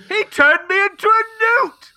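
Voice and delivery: angry mob villager. Pretend. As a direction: whine like an outraged villager. Monty Python quote in mob scene.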